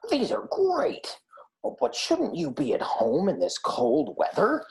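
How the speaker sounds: very high, slow delighted otter voice. A narrator's reading. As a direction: squeal thanks like a delighted otter. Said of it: "said Otter".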